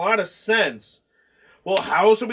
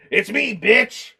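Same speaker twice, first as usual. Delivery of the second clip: mock villain; much slower than usual. Retelling Richie's reveal.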